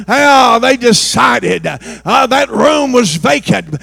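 loud shouted preaching with punches; fiery revival shouting; preaching at full force. Acted. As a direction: hammer each question at the young people. Preacher shouting about Ananias and Sapphira.

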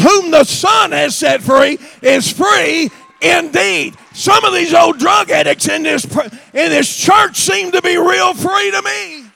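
shouted, high preaching at full force. Acted. Preacher shouting to the church about free addicts. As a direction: preach it out loud to the whole church.

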